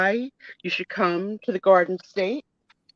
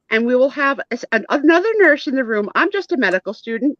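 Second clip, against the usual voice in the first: higher, lilting, over-eager nurse patter; chatty student nurse; 5 semitones higher, 4 dB louder than usual. Acted. Trainee nurse on first day briefs patient, high and excited. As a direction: run on eagerly through the instructions. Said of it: "She goes".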